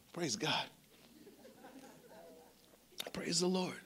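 hushed whisper of praise. Real, not acted. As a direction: breathe it out in a whisper. Quiet praise after a story.

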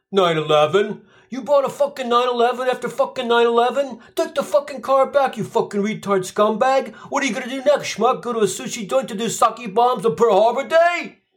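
high, outraged New York yelling; mock New York rant. New Yorker tears into Eddie for the car, high mock rage. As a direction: berate him like a furious neighbour.

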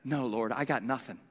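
low, soft confession to God; humble admission. Acted. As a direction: drop the voice and own up meekly. Isaiah humbly admits he has nothing.

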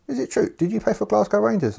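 very high put-on manager voice; new player imitating the manager's question, high; mock manager. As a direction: mimic the boss, high and eager.

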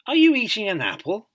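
amazed question. A narrator's reading. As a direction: ask in wide-eyed amazement. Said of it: "he explained in surprise and amazement".

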